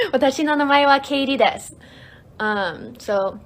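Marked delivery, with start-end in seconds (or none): 0.1-1.7 "Watashi no namae wa Kaylee desu" loud, fast and in one go; fluent, quick. Shown as a demonstration. Streamer modelling the full introduction, quick and fluent. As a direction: run the whole sentence together briskly, louder and higher.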